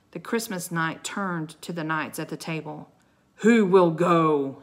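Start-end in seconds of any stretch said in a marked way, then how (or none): 3.4-4.6 loud, slow, weighty challenge; booming. A narrator's reading. Knight calls on the seated knights, booming. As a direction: boom it slowly at the whole table. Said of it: "he boomed".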